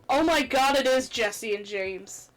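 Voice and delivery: usual speaking voice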